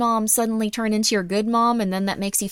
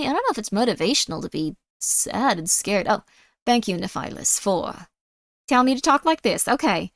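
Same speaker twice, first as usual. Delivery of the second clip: ordinary talk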